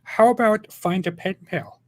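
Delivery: high, slow hammed-up offer; comic angel voice. Gabriel talking to Muhammad in a skit, comic put-on voice. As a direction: draw out the question high. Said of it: "and he said".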